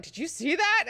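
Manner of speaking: high-pitched scandalized disbelief, played for laughs; mock shocked question. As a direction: ask it like a stunned witness seeking confirmation. Duncan's shocked aside to Irulan.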